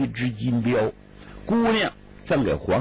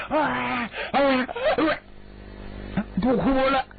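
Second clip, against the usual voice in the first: high, sobbing through the words; girl caught mid-cry blurting that she'll stop, high and tearful; 11 semitones higher, much slower than usual. Acted. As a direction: blubber it out between sobs, pitched high. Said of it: "姑娘正哭呢".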